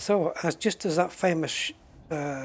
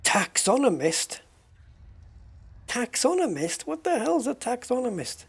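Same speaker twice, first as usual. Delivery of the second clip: higher and higher, disbelieving; baffled; 8 semitones higher than usual. Real, not acted. Baffled by an unknown word.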